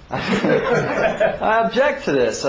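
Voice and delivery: slow, high, drawn out through laughter; laughing objection. Retold or imitated. Defendant objecting in court, laughing slowly. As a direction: stretch the objection while chuckling.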